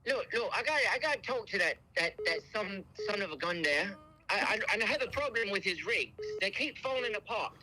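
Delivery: high, put-on British complainer; British accent. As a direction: fake aggrieved customer with a British accent. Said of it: "the best British accent I've ever heard".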